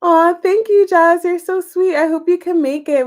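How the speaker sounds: delighted sweet thank-you; touched thanks. Real, not acted. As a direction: gush gratitude to Jazz. Thanking a viewer named Jazz.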